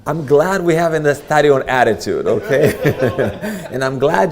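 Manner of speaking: a real laugh breaking into the words. Real, not acted. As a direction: speak while still laughing. Laughing through the closing remark.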